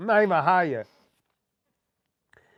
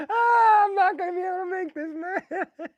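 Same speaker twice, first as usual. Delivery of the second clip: crying laughing; 12 semitones higher than usual. Real, not acted. Cry-laughing.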